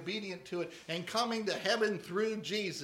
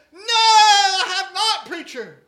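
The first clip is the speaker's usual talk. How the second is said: much higher, very loud, slow wail that drops off; wailing drunk; 11 semitones higher, 18 dB louder, slower than usual. Acted. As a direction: cry it out like a soaked old drunk. Drunk half drowned, crying out.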